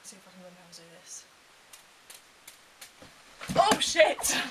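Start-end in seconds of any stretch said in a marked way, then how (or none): 3.6-4.4 sudden loud "oh shit" as something goes wrong; startled. Real, not acted. Vlogger caught off guard by a mishap, startled loud curse.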